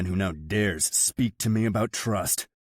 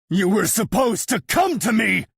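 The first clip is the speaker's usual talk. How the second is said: high, loud roaring reproach; 9 dB louder than usual. A narrator's reading. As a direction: roar it at him. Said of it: "I roar".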